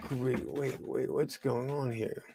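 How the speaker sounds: ordinary talk, nothing marked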